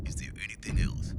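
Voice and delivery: hushed, quick, holding back anger; forced calm. Retold or imitated. Dad holding in his fury on the phone, hushed and quick. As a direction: swallow the rage and ask politely. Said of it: "calms himself down, and goes".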